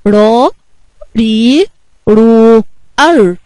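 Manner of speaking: separate, held "را، ري، رو، ار": raa, rii, ruu, arr; letter sound drill. Shown as a demonstration. Teacher reviewing sounds with children, each of "را، ري، رو، ار" drawn out. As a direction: chant each r syllable long with pauses between. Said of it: "نراجع الاصوات تاني مع بعض".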